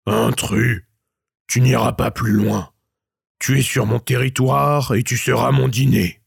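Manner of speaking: menacing troll growling at the intruder; cavernous troll voice. A narrator's reading. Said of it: "D'une voix caverneuse, il s'écria". (voix caverneuse de troll, légèrement jouée)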